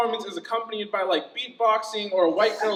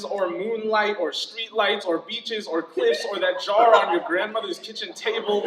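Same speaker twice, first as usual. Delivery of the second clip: ordinary delivery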